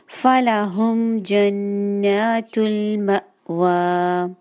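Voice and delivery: slow फलाहुम जन्नातुल मअवा with held vowels; slow correct recitation. Shown as a demonstration. Teacher models the verse slowly. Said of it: "फलाहुम नहीं है".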